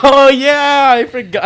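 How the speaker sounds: high, very loud excited "oh yeah"; real sudden recollection. Real, not acted. Storyteller reminded the night wasn't all terrible, excited outburst. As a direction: burst out loudly as the memory hits.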